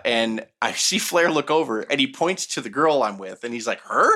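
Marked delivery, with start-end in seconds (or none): 3.8-4.1 retold surprised question, pitch jumping up; puzzled question. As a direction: ask it with mock surprise. Retold surprised question about the girl.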